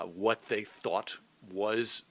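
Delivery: ordinary talk, nothing marked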